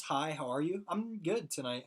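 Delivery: ordinary delivery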